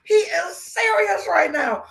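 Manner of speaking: high-pitched, laughing retelling; cracking up in mock disbelief; amused disbelief. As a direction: squeal it through laughter. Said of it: "it was so funny to me".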